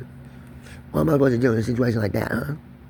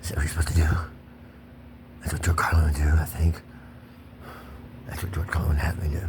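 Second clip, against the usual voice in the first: whisper; 6 semitones lower, 4 dB quieter than usual. Real, not acted. Whispering after the shouting rant.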